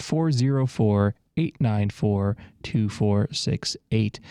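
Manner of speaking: slow, spaced-out phone number. Real, not acted. Radio host repeating the number for callers, slowly. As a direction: read the digits slowly, group by group. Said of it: "I said that kinda fast".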